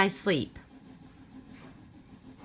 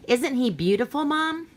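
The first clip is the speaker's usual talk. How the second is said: put-on high, pleading little kid voice; a child coaxing mom, voice rising; eager child; 8 semitones higher than usual.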